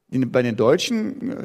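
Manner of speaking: usual speaking voice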